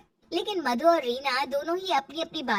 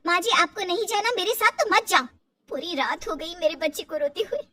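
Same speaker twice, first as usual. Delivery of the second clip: raised, angry complaint to the mother-in-law; fed up and angry, voice pushed higher; 5 semitones higher, 4 dB louder than usual. Acted. Daughter-in-law angrily tells her mother-in-law she'll go alone. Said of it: "Reena ने गुस्से से अपनी सास से कहा".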